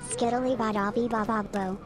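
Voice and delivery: usual speaking voice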